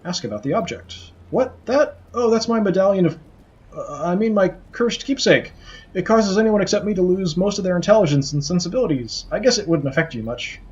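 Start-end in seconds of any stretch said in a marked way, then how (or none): 1.3-10.6 high nervous fumbling skull; flustered skull voice. Acted. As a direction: caught out and covering. Skull flustered about medallion.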